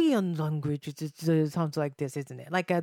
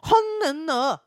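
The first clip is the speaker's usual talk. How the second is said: high, dipping imitation of Korean; put-on Korean intonation; mock Korean sounds; 8 semitones higher, 4 dB louder, faster than usual. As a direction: exaggerate a Korean tune, voice pitched up. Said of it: "the Korean sound always has a little dip".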